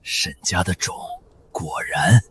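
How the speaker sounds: very low, halting, hushed; soft, deep, labored menace; weak, venomous curse. Acted. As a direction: force out a curse in broken pieces. Captured assassin curses her family.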